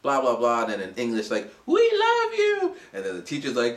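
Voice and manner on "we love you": squeaky drawn-out student voice; high slow student chorus; high, slow mock student voice. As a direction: imitate shy students saying it in English. Students telling teacher in English.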